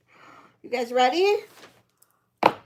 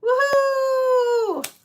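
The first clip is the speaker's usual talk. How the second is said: cheering; 13 semitones higher, 8 dB louder, slower than usual. Real, not acted. Celebrating a buyer's first purchase.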